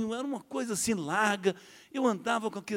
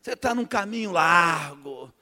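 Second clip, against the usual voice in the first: high, slow, louder preaching; preacher laying into him; 6 semitones higher, 5 dB louder, slower than usual. Retold or imitated. Preacher scolding the wayward man, slow high preaching. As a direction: hammer the sinner from the pulpit. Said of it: "cascando em mim".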